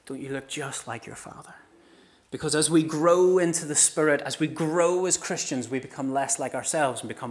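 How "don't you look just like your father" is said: soft, wondering greeting; acted tender astonishment; hushed amazement. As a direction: marvel quietly at a likeness. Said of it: "we'll grab each other and go".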